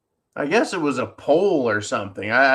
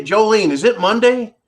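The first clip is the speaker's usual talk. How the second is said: calling out; 6 semitones higher, 4 dB louder than usual. Real, not acted. Calling out to Jolene off-mic.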